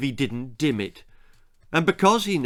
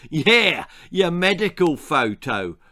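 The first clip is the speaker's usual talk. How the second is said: louder, higher jeering chorus; gang chorus; 5 semitones higher, 5 dB louder than usual. A narrator's reading. Gang jeering at the new boy in unison, loud. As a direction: chant it like a gang of bullies. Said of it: "chorus of".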